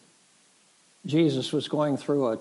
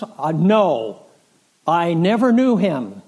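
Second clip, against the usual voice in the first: louder, insistent acted protest; emphatic denial; 2 semitones higher, 6 dB louder, slower than usual. Peter denies Jesus to his questioner, insistent. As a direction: deny it firmly, voice raised.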